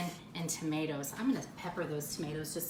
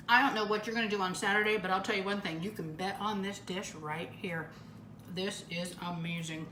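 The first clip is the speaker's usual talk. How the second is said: ordinary talk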